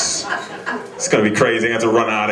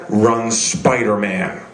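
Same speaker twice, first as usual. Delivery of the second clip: ordinary delivery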